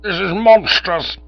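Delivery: high, loud, slow indignation; outrage. Acted. Duke outraged at being doubted, high, loud and slow. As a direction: spit out the insult to his honour.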